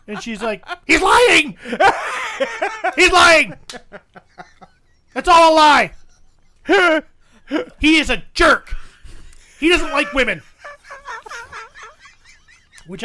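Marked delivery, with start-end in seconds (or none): 0.9-10.4 loud, high mock yell of accusation; imitated screaming. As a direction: yell it out like an outraged heckler. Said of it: "Heidi's still screaming".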